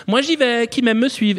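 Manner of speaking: voix de maman chantante, aiguë; mère enjouée. Retold or imitated. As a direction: imiter une mère guillerette. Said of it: "elle dit à ses gamins". (maman qui chantonne à ses enfants)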